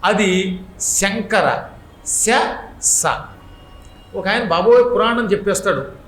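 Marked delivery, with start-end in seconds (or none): 0.0-3.0 శ said palatal, స said dental, slowly; palatal versus dental. Shown as a demonstration. Preacher demonstrating the two sibilants to the audience, slowly. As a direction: contrast శ and స slowly, tongue on palate then teeth. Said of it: "దానికి దీనికి తేడా".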